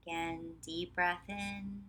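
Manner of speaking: measured meditation voice with long pauses; guided meditation. Real, not acted. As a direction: guide them calmly, leaving space after each phrase. Leads a guided relaxation practice.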